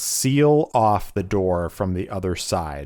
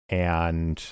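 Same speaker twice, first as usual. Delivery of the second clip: ordinary delivery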